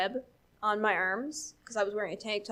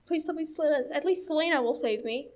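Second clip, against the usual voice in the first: higher-pitched, lightly panicked plea; mock pleading; 6 semitones higher, faster than usual. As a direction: act out a scared kid hoping for rescue. Retells her frightened plea for rescue.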